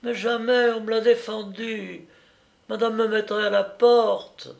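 high, protesting servant; maid's voice. A narrator's reading. Maid telling her master she'd be sacked, high protest. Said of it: "La bonne, cette chère Louison".

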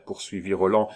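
usual speaking voice

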